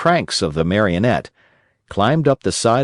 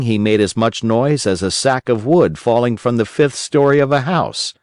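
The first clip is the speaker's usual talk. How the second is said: ordinary delivery, nothing marked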